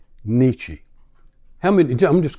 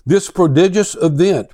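a raised, declaiming reading voice; dramatic reading; 3 semitones higher, 4 dB louder than usual. Acted. Preacher reads The Madman to his congregation, dramatic reading. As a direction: read it like a tale of a madman.

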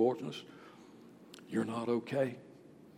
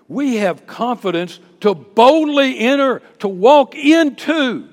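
loud, raised, building proclamation; full-force preaching; 8 semitones higher, 14 dB louder, slower than usual. Real, not acted. Preacher urging his church to enter boldly, full-force preaching. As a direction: build boldly, stretching the final words.

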